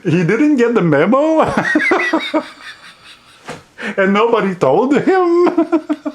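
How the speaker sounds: high, giggling through the words; can barely get it out for laughing; real giggles at how weird the film is. Real, not acted. Laughing at Ian McKellen's meowing.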